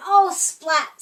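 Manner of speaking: lightly voiced happy squeak. A narrator's reading. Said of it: "squeaked Seymour".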